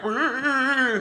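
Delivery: high wordless yelp of alarm; mock freak-out. Stranger freaks out at a hello, high mock wail. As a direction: imitate a startled stranger's wail. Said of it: "had that person freak out".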